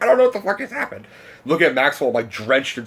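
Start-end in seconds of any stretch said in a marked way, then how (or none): 0.0-1.1 fast, high-pitched disbelief; overwhelmed. Retold or imitated. Fan turns to his friend after the exchange, overwhelmed. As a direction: gush in a frantic high voice.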